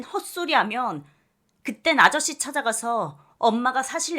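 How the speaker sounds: shouted ultimatum; furious warning. Acted. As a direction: daughter threatening her mother one last time. Daughter shouts final warning.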